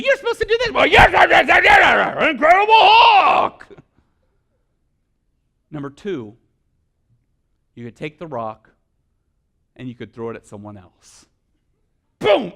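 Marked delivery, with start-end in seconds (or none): 0.0-3.7 high, loud hammed-up yelling; mock furious shout. Person throwing the rock back, with a mock furious shout. 12.2-12.5 mock boom. Acted rock impact.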